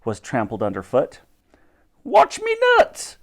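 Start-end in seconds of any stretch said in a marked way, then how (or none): 2.0-3.2 very high, slow drawn-out cry; stallholder's yell. A narrator's reading. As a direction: yell a warning like a market trader. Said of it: "yelled another".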